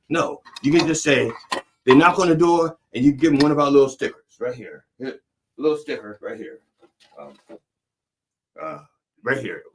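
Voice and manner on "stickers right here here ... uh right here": softer, muttered demo of the sticker; quiet aside. Acted. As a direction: drop it down, showing something close.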